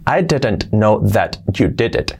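slow, with every t and d; over-correct articulation. Shown as a demonstration. Teacher shows it is hard to say, over-correct articulation. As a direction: articulate each word apart, unlinked. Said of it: "Pronunciando las T's y las D's correctamente".

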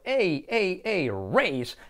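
repeated "ey" leading into "raise"; ey vowel drill. Shown as a demonstration. Teacher links raise to its vowel, ey drill. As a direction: punch "ey" thrice, then "raise". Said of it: "Letra clave R también la segunda".

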